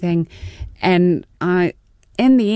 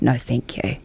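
soft whisper; 5 semitones lower, 5 dB quieter than usual. Retold or imitated. Retelling her own polite refusal.

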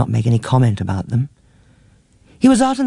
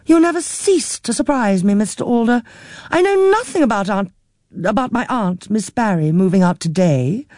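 higher, gently explaining; a lightly voiced patient middle-aged niece; 6 semitones higher than usual. A narrator's reading. Niece reminds forgetful aunt who Christopher is, patiently. Jean surprised on phone.

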